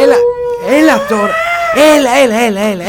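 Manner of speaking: high, urgent coaxing that breaks into a shout; panicked pleading. Acted. Begging the weeping creature to stop, panicked. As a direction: beg him frantically to stop.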